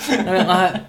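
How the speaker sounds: ordinary delivery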